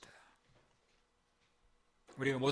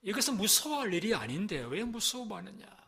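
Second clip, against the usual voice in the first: ordinary talk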